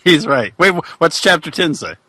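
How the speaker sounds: ordinary talk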